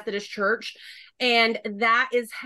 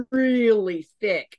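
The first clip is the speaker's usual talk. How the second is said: drawn-out Southern drawl, louder on "really"; thick Southern accent; 4 dB louder, slower than usual. Shown as a demonstration. Southerner telling how her accent thickens, thick Southern accent.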